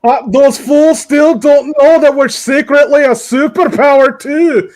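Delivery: smug mock Scot boasting; Scottish accent. Playful Scottish role-play.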